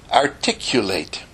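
"articulate" in distinct, complete syllables; coach's exact enunciation of "articulate"; carefully articulated. Shown as a demonstration. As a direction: sound out every part of "articulate". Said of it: "speak the words completely".

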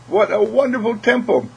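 high-pitched, wondering exclamation; admiring delight. Acted. Admires own body aloud.

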